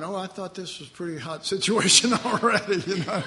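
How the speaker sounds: joking through a laugh. Retold or imitated. Preacher imagines the men's wry reaction, laughing. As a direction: chuckle out the line as a wry aside. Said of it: "you're going".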